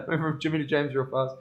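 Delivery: laughing through the repeated line; amused repeat, laughter in the voice. Real, not acted. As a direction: chuckle the words out as you repeat them. Repeating a friend's joke.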